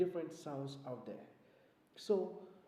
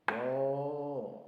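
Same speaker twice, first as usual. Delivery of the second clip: loud held moo; cow moo; 8 dB louder than usual. Shown as a demonstration. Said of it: "start with the sound of a cow".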